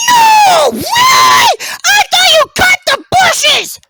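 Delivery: very high, very loud drawn-out squeal; mock amazement. Teasing the lawnmower about its job, shrieked mock amazement. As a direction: shriek slow, exaggerated disbelief.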